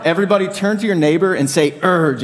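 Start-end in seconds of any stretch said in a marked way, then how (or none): 1.8-2.2 raised pirate-style URGE; a quick, high pirate URGE. Shown as a demonstration. Preacher telling everyone to say it to a neighbour, pirate voice. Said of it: "in your best pirate voice".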